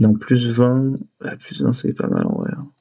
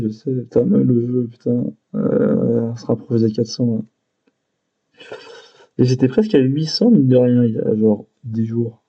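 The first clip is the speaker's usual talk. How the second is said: ordinary talk, nothing marked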